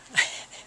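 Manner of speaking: short breathy nervous laugh; embarrassed little laugh. Real, not acted. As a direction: laugh it off awkwardly. Laughs at hard question.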